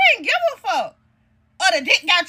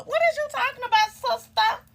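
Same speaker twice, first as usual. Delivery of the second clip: mocking sister's line; 5 semitones higher, 5 dB quieter than usual. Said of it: "Don't never say no sister".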